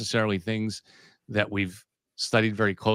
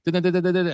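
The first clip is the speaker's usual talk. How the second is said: high, rapid-fire "da-da-da" news ticker; sung-out telegraph chatter, pitched up; imitated telegraph noise; 8 semitones higher, faster than usual. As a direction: mimic a telegraph under a news bulletin. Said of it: "he would do that little".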